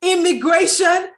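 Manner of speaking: astonished, loud and high, drawn out; thrilled disbelief, each word stretched; real amazement. Real, not acted. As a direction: marvel slowly at the coincidence, loudly. Amazed at the coincidence.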